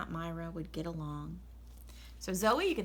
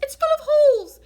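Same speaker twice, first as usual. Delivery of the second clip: shrieked much higher and louder; exclaimed; 16 dB louder than usual. Acted. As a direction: cry out in horror at the ruined dress. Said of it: "exclaimed Missus Rogers".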